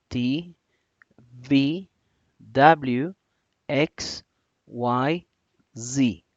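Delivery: each letter said alone, clear English letter names. Shown as a demonstration. Teacher reciting English letters for students. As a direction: recite the alphabet to the class. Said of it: "voy a proceder a pronunciarlas en inglés".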